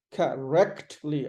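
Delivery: Correctly slowly as kuh, REKT, lee; "Correctly" in parts, weak first vowel; stressed R syllable. Shown as a demonstration. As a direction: break Correctly up, leaning on rect. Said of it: "Ah has the stress".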